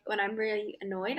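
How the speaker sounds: usual speaking voice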